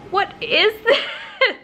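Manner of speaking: high slow laughing whine; laughing exasperation. Real, not acted. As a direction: whine through laughter at the hair. Exasperated at hair.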